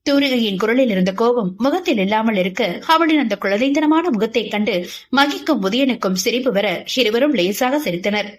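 ordinary delivery, nothing marked

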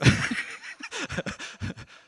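high breathy laugh. Real, not acted. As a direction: burst of laughter. Laughing at the memory.

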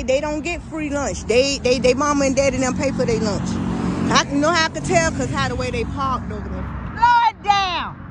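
normal talking voice

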